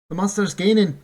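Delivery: loud urgent call; urgent report. Acted. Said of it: "Bink reported".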